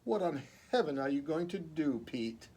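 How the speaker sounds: ordinary delivery, nothing marked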